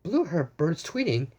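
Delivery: ordinary delivery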